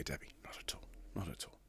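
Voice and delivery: soft hushed reassurance; whispering to Debbie that she's not in trouble; whispered aside. Acted. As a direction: drop to a whisper, teasing.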